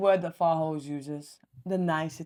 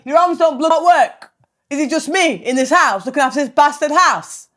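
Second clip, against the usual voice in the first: loud, high exasperated yelling; angry rant; 7 semitones higher, 12 dB louder than usual. Retold or imitated. Wife phoning husband at the cafe, ranting loudly. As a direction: explode down the phone at him.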